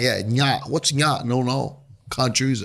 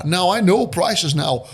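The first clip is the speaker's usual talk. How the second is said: normal talking voice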